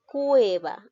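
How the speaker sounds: slow example word. Shown as a demonstration. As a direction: read out cueva slowly as an example. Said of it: "Aquí observamos". (cueva leída despacio, como ejemplo)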